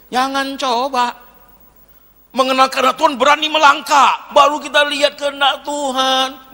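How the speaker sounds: loud, high-pitched exhortation; preaching at full force. Real, not acted. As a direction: urge the congregation forcefully. (berkhotbah lantang penuh semangat)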